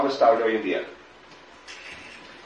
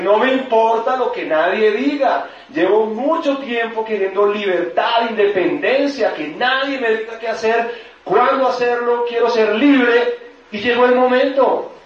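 liberated outcry; 11 semitones higher, 5 dB louder than usual. Acted. Employee imagines breaking free from the job, liberated outcry. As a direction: proclaim freedom at the top of your pitch. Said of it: "fue como la liberación".

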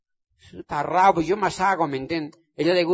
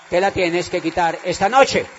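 louder, commanding end of the refrain; loud exhortation; 6 dB louder than usual. Acted. Preaching to the audience, building to a loud command. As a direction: drive it home, louder.

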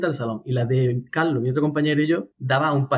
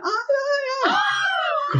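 very high squealing shrieks of panic; screaming in fright; much slower than usual. Retold or imitated. Flatmates spotting a huge cockroach, hammed-up high shrieks. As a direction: shriek like you just saw a giant bug. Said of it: "gritar como mariconas".

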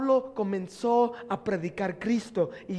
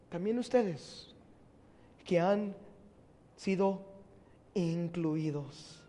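hushed repetition; 4 semitones lower, 10 dB quieter, slower than usual. Acted. Pastor repeats verse hushed.

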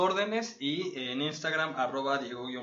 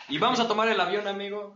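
excited announcer; 6 semitones higher, 4 dB louder than usual. Acted. Host announcing travel.

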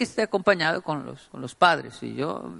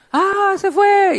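much higher, slow, drawn-out; casual shrug; 7 dB louder, much slower than usual. Retold or imitated. In the video someone shrugs that the friend is gone, high and slow. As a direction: shrug off the loss lightly.

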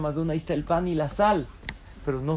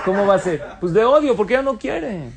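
loud and higher through a laugh, "¿Cómo va a ser?" pushed out; laughing punchline; 4 semitones higher, 8 dB louder than usual. Real, not acted. Rabbi joking to the congregation about his girlfriend, laughing loudly. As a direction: land the joke on his own love life, laughing.

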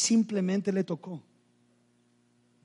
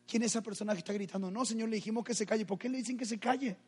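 fast, softer acted dialogue; quick hushed exchange; 3 semitones higher, 8 dB quieter, faster than usual. Jesus questioning those who silenced the man, quick and hushed. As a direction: rattle it off in an undertone.

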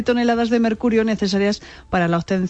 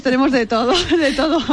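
higher, real laugh breaking in; 5 semitones higher than usual. Real, not acted. Reporter boasts to host that province has everything, laughing. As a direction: say it through a real chuckle. Joking about her province's attractions.

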